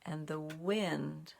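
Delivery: put-on slow, gentle read-aloud for children; story narration. A narrator's reading. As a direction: draw the little ones into the tale, unhurried. Reads a picture story aloud.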